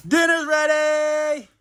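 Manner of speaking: high, slow call to dinner; yelling across the room. Real, not acted. Calling family to dinner.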